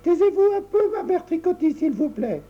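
put-on high, bright little girl's voice, politely firm. La petite fille Tricotta demande poliment le silence, voix aiguë. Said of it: "répondit poliment la petite fille".

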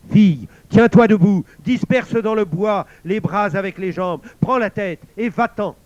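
the sword roaring orders at Lauriane; roaring command. A narrator's reading. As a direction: roar at her to get up. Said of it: "l'épée rugit".

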